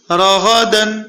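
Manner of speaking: راغدن run together in one quick breath; read quickly. Shown as a demonstration. Quran teacher to pupils, quick joined reading. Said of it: "جلدی پڑھیے".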